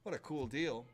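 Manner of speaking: ordinary talk, nothing marked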